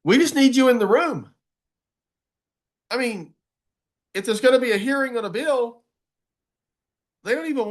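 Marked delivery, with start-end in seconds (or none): none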